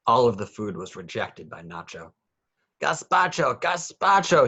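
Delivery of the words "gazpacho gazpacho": high, loud chant of the word; crying out. A narrator's reading. Nacho demands gazpacho from Mommy, high and loud. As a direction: beg for it with all he has. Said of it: "he cried".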